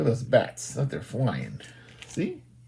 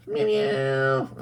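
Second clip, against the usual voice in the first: very high playful meowing; cat meow. Pretend. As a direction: meow like a little kitten. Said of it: "and say meow and peekaboo".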